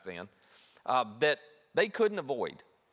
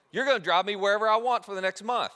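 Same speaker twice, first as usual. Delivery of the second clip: louder, higher, gloating; bossy demand; 5 semitones higher, 5 dB louder than usual. Retold or imitated. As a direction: lay down terms like a little tyrant. Younger sibling making demands.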